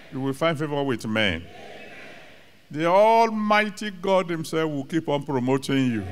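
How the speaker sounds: usual speaking voice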